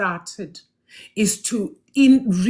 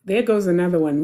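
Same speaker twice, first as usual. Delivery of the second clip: usual speaking voice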